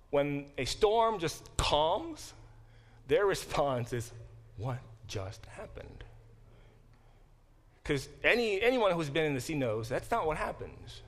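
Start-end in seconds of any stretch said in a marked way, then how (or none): 4.6-6.0 hushed, slow, stunned question; retold hush of disbelief. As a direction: voice the stunned onlookers softly, word by word. Retold disciples stunned by sudden calm.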